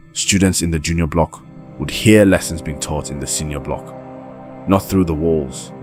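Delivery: usual speaking voice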